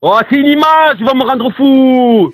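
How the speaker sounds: very high, drawn-out cry of exasperation; high, slow and fed-up outburst; exasperated outcry. Real, not acted. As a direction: wail in disbelief, pushed to your limit. Exasperated victim of prank call.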